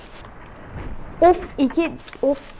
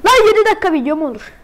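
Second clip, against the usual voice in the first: louder, faster, outraged; exasperated shout; 5 semitones higher, 6 dB louder, faster than usual. Real, not acted. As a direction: yell it in disbelief. Streamer complaining about restart.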